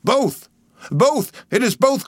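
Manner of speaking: high, elated cry; joyful shout. A narrator's reading. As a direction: shout the good news with joy. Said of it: "again with a joyful shout".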